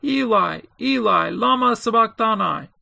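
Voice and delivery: narrator's restrained loud cry. A narrator's reading. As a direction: call out to God, voice lifted. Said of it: "cried out with a loud voice".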